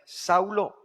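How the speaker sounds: calling out Saulo. Acted. Voice of God calling Saul.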